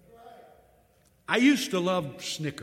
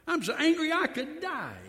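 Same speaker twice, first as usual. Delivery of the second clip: higher, pleading mock outburst; angrier repeat; 10 semitones higher than usual. Preacher repeating Jonah's protest to God, raised mock outburst. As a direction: cry it out like a prophet at the end of his rope. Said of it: "I told him twice".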